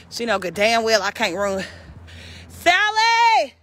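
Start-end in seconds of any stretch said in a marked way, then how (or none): 2.5-3.5 loud, high holler; high yell; yelling a name. Real, not acted. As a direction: shout the name across the field. Yelling for the pig.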